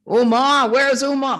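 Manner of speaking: loud, high searching call. Retold or imitated. Imitating the man looking for Uma, calling out. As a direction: imitate someone calling for a missing person.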